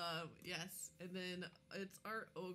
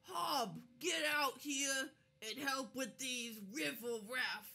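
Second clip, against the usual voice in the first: high, loud, slow ogre holler; ogre calling out; 6 semitones higher, 6 dB louder, slower than usual. Acted. Ogre Nob shouting for help from his partner. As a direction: call a partner out of the cave, hammed up. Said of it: "And then he goes".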